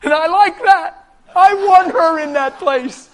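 high, loud emphatic shout; exultant preaching. Real, not acted. Preacher wants her in that place, high exultant shout. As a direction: belt it out to the congregation, punching each word.

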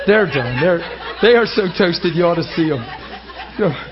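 laughing. Real, not acted. Laughing at emotional crowd.

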